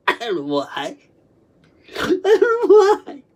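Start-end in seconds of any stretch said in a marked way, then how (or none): 2.0-3.2 high, loud, drawn-out cry; anguished wail. Retold or imitated. Recalling classmates laughing at them, anguished high wail. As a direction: wail it slowly, pitched way up.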